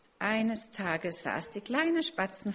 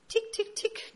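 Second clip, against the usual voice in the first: very high tapping ticks; tapping egg. Acted. Chick knocks from inside the egg, very high ticks. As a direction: tap it out like a beak on an eggshell. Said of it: "klopfte es".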